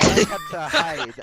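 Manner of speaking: laughing through the words; giggling while speaking. Real, not acted. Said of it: "laughing while telling".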